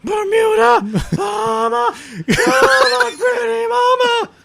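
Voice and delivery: very high, feminine shriek; high-pitched Night King. Pretend. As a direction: squeal it like a girly villain. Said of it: "super high-pitched and very feminine".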